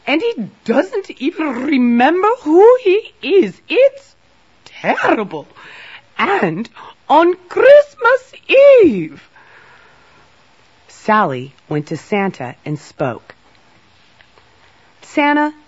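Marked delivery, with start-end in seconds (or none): 0.1-5.5 shrieking high, distraught queen; worried queen. A narrator's reading. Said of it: "She was very worried". 6.2-9.3 very high, loud, drawn-out lament; distraught wail. A narrator's reading. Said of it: "She was very worried". 15.0-15.5 high, loud call. A narrator's reading. Sally calls to dazed Santa.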